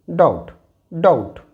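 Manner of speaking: doubt said as dout, no b; silent b demo. Shown as a demonstration. Teacher showing students the silent b in doubt. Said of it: "it is".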